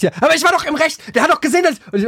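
imitating his own whiny protest; whiny self-defence. Retold defence against wife's reproach.

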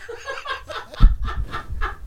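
high breathy burst of laughter; laughing. Real, not acted. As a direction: laugh it out, amused at yourself. Laughing at own carelessness.

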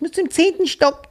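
put-on high little girl voice; child's voice. Girl tells him her floor, high child voice. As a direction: say it like a small girl. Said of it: "Der so".

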